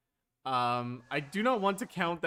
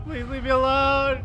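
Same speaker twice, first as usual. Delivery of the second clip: much higher, louder terrified repeat of the plea; shrill pleading scream; 12 semitones higher, 13 dB louder than usual. Real, not acted. Player begs the enemy again, screaming higher in terror. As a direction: push the plea to a near shriek. Player begging chasing monster.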